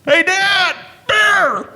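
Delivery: high, loud yell of alarm; warning shout. Pretend. Son warning Dad about the bear, a high loud shout. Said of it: "Now, I could have said".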